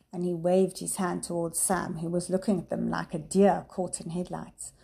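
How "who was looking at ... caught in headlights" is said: usual speaking voice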